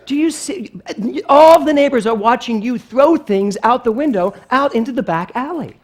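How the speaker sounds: loud, high scolding; appalled mother. Retold or imitated. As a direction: imitate a mom mortified before the neighbours.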